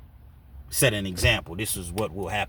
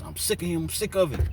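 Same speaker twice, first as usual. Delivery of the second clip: higher, rising exasperation; fed-up outburst; 10 semitones higher than usual. Retold or imitated. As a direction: vent like you've had enough. Voicing Omar's frustration.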